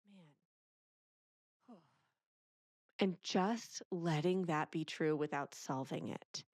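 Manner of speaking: usual speaking voice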